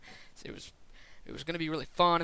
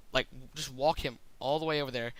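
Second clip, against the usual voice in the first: ordinary delivery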